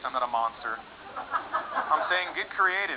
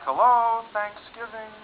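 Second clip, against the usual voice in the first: sarcastic retort; 6 semitones higher, much slower than usual. Acted. Mocking friend at dinner.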